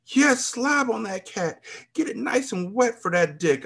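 high, slow, drawn-out moan; aroused groan. A narrator's reading. Woman urging Juan on, high slow moan. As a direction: stretch it out high with pleasure. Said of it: "I groaned".

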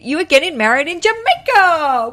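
building to a high, loud cheer; elated. Real, not acted. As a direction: burst out with delight for the bride. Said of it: "I'm elated".

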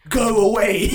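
loud, high mock shout. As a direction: bark it like the rude customer. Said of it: "he raised his voice again".

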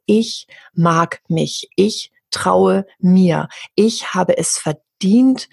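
very slow, deliberate self-affirmations. Shown as a demonstration. Teaching listeners self-love phrases, slowly and deliberately. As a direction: savour each word of the affirmation slowly. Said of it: "dass ich anfange und sage".

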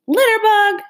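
high, loud shout after a truck; screaming. A narrator's reading. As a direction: yell it after the disappearing taillights. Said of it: "she screamed after the".

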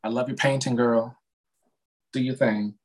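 ordinary talk, nothing marked

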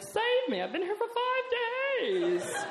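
very high, drawn-out mock wail; desperate plea. Trapped partygoer grabbing her foot for help, desperate plea. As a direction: wail for rescue in a squeaky high voice. Trapped guy pleads for rescue.

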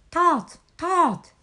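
high, loud call to a friend; storybook Frog shouting; shouted call. A narrator's reading. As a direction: shout the name twice, pitched high. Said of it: "shouted Frog".

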